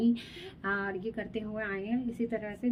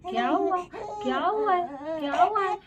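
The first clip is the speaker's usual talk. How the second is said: high, sing-song coaxing, a little louder; 7 semitones higher, 3 dB louder than usual. Real, not acted. Mother soothing her crying baby.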